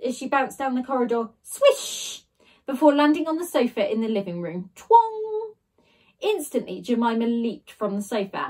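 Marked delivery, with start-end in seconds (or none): 1.4-2.2 swish. A narrator's reading. Flying through air. 4.8-5.5 high, loud springy twong; boing like a spring; twong sound effect. A narrator's reading. Narrator voicing her landing on the sofa, high.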